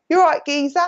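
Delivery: put-on a light Essex geezer accent. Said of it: "this is me in my Essex, Essex, Essex accent".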